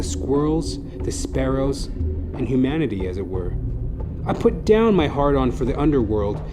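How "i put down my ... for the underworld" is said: ordinary delivery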